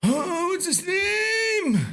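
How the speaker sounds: high gasp, then slow exclaim; sudden gasp. Real, not acted. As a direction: gasp as the name comes back. Suddenly remembering a name.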